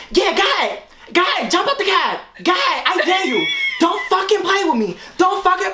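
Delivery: high, heated mock threat; retold daring. Imitating friend daring a jump from cab, angry.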